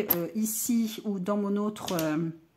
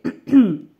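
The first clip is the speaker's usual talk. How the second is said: throat clearing; 12 dB louder than usual. Real, not acted. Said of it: "Essayez de pas trop m'étouffer".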